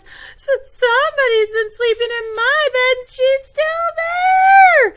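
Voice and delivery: very high, loud, shrieking little bear; stammer, then squeal in mock alarm; Baby Bear. Finding Goldilocks in his bed, squealing astonished little bear. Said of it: "his eyes get really big and he says".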